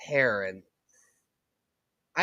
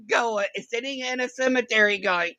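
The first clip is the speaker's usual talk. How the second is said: ordinary talk, nothing marked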